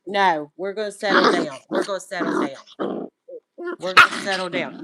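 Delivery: loud, insistent no-no-no; firm loud scolding. Real, not acted. Owner breaking up fighting goats, loud and firm. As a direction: shout them apart.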